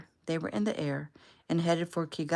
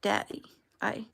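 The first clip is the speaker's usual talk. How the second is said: mild tearful catch, pitched higher; higher, choked-up daughter's voice; tearful, breaking off; 4 semitones higher than usual. A narrator's reading. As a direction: start tearfully, then break off. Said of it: "she broke off".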